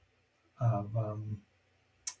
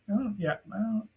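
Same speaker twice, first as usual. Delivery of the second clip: ordinary talk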